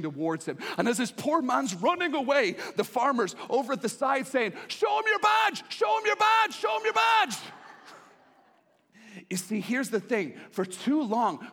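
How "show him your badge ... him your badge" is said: high, loud taunting yell; mocking shout. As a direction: yell it after the running man. Farmer yelling at fleeing man.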